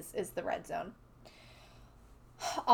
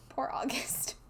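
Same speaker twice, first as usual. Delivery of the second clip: soft pitying whisper; 3 semitones higher, 5 dB quieter than usual. Real, not acted. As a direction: breathe it out with pity. Pitying aside during recap.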